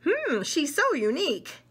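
ordinary delivery

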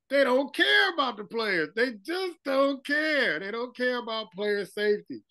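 high, drawn-out impassioned protest. Retold or imitated. Co-host imagining his colleague's reaction to the news, high mock protest.

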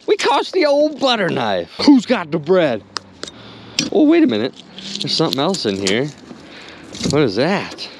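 normal talking voice

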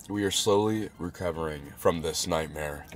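low, soft, drained delivery; worn-out recovery. Real, not acted. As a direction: sound spent after an ordeal. Worn out after the burn.

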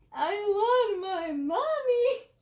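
high, slow wailing cry for mommy; sobbing high and drawn out; hysterical sobbing. A narrator's reading. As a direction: bawl it out like a terrified child. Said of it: "I sobbed hysterically".

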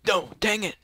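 loud, high cry of frustration; frustrated yell. Real, not acted. Player losing a round, frustrated yell. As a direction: yell it out, exasperated. Loses in the game.

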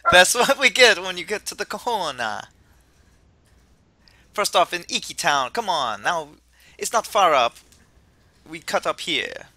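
put-on easygoing would-be Jamaican accent; attempted Jamaican accent. Kukui welcomes the player to Alola, attempted Jamaican accent. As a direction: do an amateur Jamaican voice, hammed-up. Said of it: "I know I can't do Jamaican".

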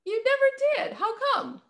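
real surprise, pitch jumps high; surprised. Real, not acted. Disbelief at no accent.

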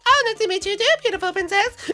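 very high, sing-song reply; high mock suitor voice. The mole courting the princess in a squeaky voice. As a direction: pipe it up sweetly.

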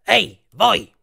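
loud, high street hail; sharp challenge to passers-by; calling out. A narrator's reading. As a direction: shout it from down a dark alley. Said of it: "una voce nel buio disse".

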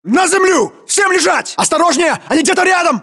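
high, urgent commands to take cover; alarmed combat shouting; barked orders. Acted. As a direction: yell the squad down to the ground.

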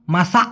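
"মাসা" with a short last vowel checked by a silent k; final K cut short. Shown as a demonstration. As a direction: say "মাসা" as masak, the k held back. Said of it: "এরকম উচ্চারণ হবে". ("মাসা" শেষে আটকে যাওয়া ক-এর উচ্চারণ)

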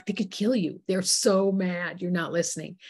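usual speaking voice

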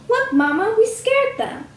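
put-on high, loud childlike squeal. A narrator's reading. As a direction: squeal it proudly to mama, pitched high. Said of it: "the small shapes squealed".

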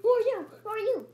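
loud high frantic calls; searching in a panic; calling out. Acted. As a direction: shout for the lost pet.

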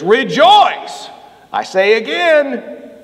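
loud, high ringing proclamation; booming Paul rejoice; Paul proclaiming. Retold or imitated. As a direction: call it out like an apostle. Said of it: "he says".

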